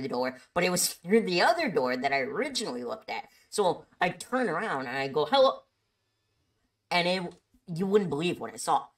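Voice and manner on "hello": quick, high, raised greeting; calling out. Retold or imitated. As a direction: toss a loud hello toward the door. Turning to greet another customer.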